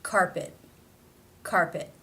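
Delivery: "Carpet" ending in an unreleased T; stop T. Shown as a demonstration. Teacher showing a word-final T, stopped in "Carpet". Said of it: "it is a stop".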